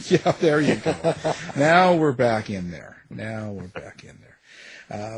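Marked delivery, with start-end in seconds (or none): 1.6-3.0 louder, punchy radio host announcement. Pretend. Host announcing return to studio. 3.1-5.2 much softer and slower, trailing away; quiet slow echo. Pretend. Host repeating the return line.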